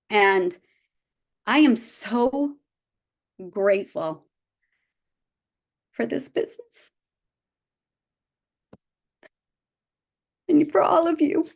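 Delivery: words catching as tears rise, pitch climbing; fighting back tears, grateful; voice breaking with emotion. Real, not acted. Speaker to colleagues, choking up over gratitude for the business. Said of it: "sorry, I didn't wanna get emotional".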